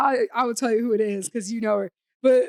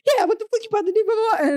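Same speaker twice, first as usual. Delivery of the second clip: high-pitched mock menace; mock threatening; 10 semitones higher, much faster than usual. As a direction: imitate a sister squaring up to fight. Sister looms over girl, threatening.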